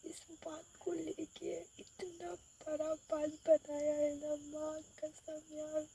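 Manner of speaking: hushed whisper, slow. Real, not acted. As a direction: whisper so nobody outside hears. Hiding in car, complaining. (छुपकर धीमी फुसफुसाहट)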